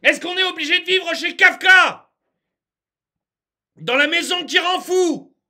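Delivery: high, loud, exasperated pleading; worked up. Real, not acted. Ranter demands an end to absurd rules, exasperated shouting. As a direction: shout each question in despairing outrage. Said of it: "je m'énerve".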